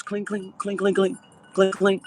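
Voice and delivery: high imitated clink of shot on glass; clinking noise. Retelling buckshot hitting the window, high clinking imitation.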